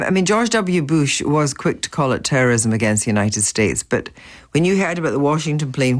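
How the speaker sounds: usual speaking voice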